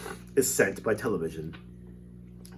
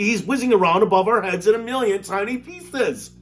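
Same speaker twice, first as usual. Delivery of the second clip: father shouting; 11 semitones higher, 7 dB louder than usual. Acted.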